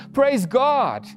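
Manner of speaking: ordinary delivery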